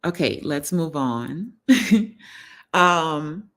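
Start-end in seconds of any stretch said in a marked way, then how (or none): 1.6-2.1 loud, high laugh; burst of laughter. Real, not acted. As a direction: laugh out loud, bright and quick. Laughing after moving on.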